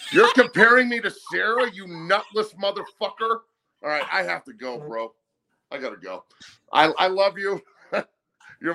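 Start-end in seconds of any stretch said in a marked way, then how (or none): 0.1-3.4 mock outrage. Real, not acted. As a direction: blow up in playful outrage at the chat. Mock outrage at chat insult.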